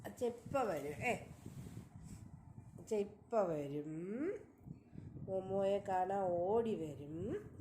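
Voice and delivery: soft, slow and low reassurance. Real, not acted. Soothing crying baby, daddy coming.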